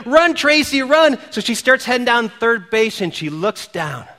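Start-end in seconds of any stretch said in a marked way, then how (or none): 0.1-1.2 higher, louder cheering call; fans screaming. Retold or imitated. Fans in the stands cheering Tracy home, loud call. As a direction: cheer her down the line. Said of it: "the fans start screaming".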